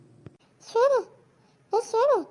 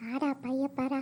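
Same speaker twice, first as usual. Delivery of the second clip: much softer and lower, hushed under breath; hushed student; 8 semitones lower, 11 dB quieter than usual. Acted. Student warning a classmate during the exam, hushed and low. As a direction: keep it down so the teacher won't hear.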